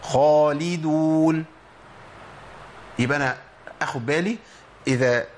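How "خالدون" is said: خالدون with a heavy full خا, slowly; slow correct demo of خالدون; heavy kha. Shown as a demonstration. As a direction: say خالدون thick and emphatic. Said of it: "ما أقولش خالدون".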